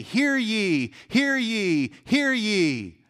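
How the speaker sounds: loud, high proclaiming call; town crier. Retold or imitated. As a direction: hands cupped, call out to the street. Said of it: "Picture the town crier".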